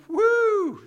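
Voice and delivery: loud, high-pitched "woo"; high whoop. Acted. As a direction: whoop as if amazed at your own words. Acting out Peter's self-satisfied whoop.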